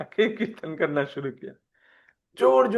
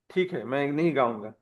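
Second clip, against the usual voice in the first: mild mock sulk, softer voice; retold huffy giving up, dropping softer; sulky; 2 semitones lower, 5 dB quieter than usual. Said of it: "तो ऐसे थोड़ा रूठ गए". (हल्का रूठा हुआ अंदाज़)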